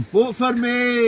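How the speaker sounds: high, loud, drawn-out plea; playful campaign shout. Pretend. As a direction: holler for votes over your friend. Mock campaigning over the friend's plea.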